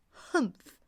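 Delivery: high, snorting camel grunt; a haughty nasal-free camel snort; scornful camel huff; camel's humph. Acted.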